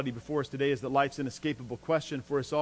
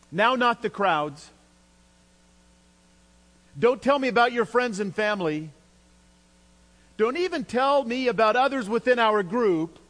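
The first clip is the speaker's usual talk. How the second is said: raised, louder, emphatic insistence; insistent imagined Jesus; 7 semitones higher, 5 dB louder than usual. Retold or imitated. Preacher imagining Jesus pressing the twelve, insistent and emphatic. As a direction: voice Jesus brushing other opinions aside, higher and louder. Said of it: "It's really as though Jesus says here".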